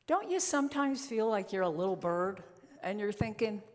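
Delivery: ordinary delivery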